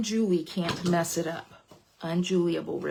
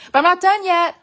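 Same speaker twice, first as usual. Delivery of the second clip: loud; 11 semitones higher, 11 dB louder than usual. Real, not acted. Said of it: "loud teasing to viewers".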